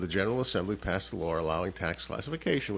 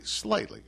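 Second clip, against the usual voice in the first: slightly repeated much higher in pitch; stress on slightly; 13 semitones higher than usual. Real, not acted. Guest qualifies the tax cut for the host, pointed stress on slightly. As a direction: lift the word slightly for careful emphasis. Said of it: "I must make sure you understand".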